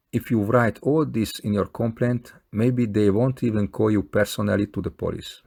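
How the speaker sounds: usual speaking voice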